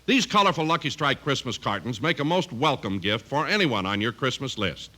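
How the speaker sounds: ordinary talk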